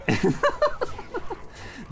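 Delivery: high-pitched burst of giggling; delighted little laugh; high giggle. Real, not acted. Laughs after winning.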